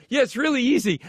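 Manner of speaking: high, breezy assurance; over-eager. Retold or imitated. Fan tells star what he wants to hear, high over-eager voice. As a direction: send your pitch way up to sell it.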